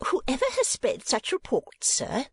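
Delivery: high, slow indignation; high-pitched ladylike umbrage; some warmth. A narrator's reading. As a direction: retort as an affronted lady. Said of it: "returned Missus Nickleby with some warmth".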